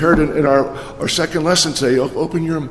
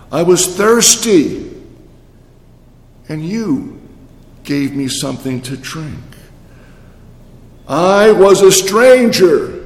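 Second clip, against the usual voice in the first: preached scripture; slower than usual. Acted. Reading Matthew slowly.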